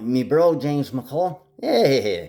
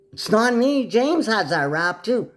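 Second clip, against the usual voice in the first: much higher and louder, furious ranting; angry rant; 12 semitones higher, 3 dB louder than usual. Real, not acted. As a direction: yell it at them, high-pitched and outraged. Denying blame, still ranting.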